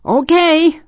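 high shouted reply; loud, high-pitched agreement. A narrator's reading. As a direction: call back over the storm. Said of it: "Mark said loudly".

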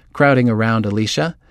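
ordinary delivery, nothing marked

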